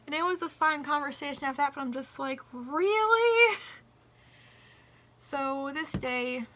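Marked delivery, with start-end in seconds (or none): 2.5-3.6 incredulous. Retold or imitated. Disbelief at his question.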